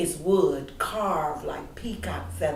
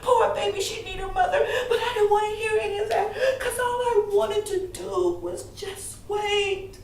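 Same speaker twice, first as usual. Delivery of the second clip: tearful, building; 10 semitones higher, 4 dB louder than usual. Acted. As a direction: spill it out, then break down crying. Child breaks down waiting for mother.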